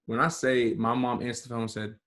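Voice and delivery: usual speaking voice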